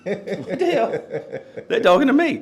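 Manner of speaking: high, laughing mock alarm; amused, incredulous squawk at being singled out; retold startled disbelief. Retold startled reaction, told laughingly.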